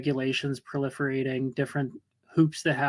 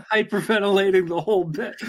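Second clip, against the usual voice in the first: real laughter breaking through, higher and louder; laughing; 5 semitones higher, 7 dB louder than usual. Real, not acted. Friend chimes in on a panicked fishing story, laughing. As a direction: laugh as you say it, voice lifted.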